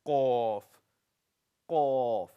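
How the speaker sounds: qaf from the very back of the tongue, deep q; letter qaf. Shown as a demonstration. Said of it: "I have one letter".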